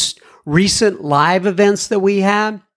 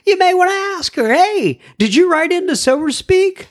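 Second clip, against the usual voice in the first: much higher, quick, chirpy put-on question; playful high voice; 12 semitones higher than usual. Host imagines someone quizzing the listener, in a high playful voice. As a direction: pop the question up high like a giddy neighbour.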